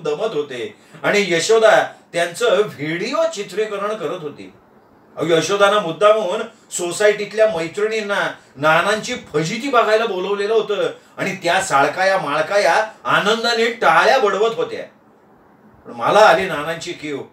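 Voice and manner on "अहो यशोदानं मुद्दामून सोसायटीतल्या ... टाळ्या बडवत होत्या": ordinary delivery, nothing marked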